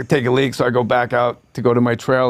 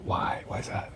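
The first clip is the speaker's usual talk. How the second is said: hushed; 15 dB quieter than usual. Retold or imitated. Narrator replies coolly.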